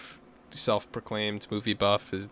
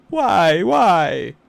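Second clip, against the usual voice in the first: high, louder drawn-out why; mock plaintive why; 7 semitones higher, 9 dB louder than usual.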